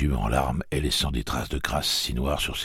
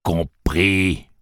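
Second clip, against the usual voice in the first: louder sharp demand; harsh demand; 3 semitones higher, 5 dB louder than usual. A narrator's reading. Tic-Tac pinching Jake's nose, harsh demand. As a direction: snap it.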